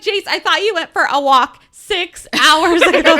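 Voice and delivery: loud, high, fed-up shout; exasperated yell. Retold or imitated. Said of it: "he's like".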